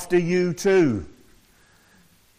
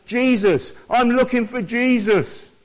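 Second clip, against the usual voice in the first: higher, louder earnest cry for Jesus; voicing Mary's longing; 6 semitones higher, 4 dB louder than usual. Preacher voicing Mary seeking her Lord, earnest and raised. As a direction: speak it as Mary, calling out for Jesus. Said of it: "He wanted her to say".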